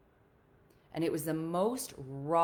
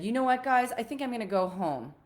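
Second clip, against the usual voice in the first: higher and a bit louder, holding back tears; shaky voice; 7 semitones higher, 6 dB louder than usual. Retold or imitated. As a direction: say it while trying not to cry. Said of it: "it was shaky".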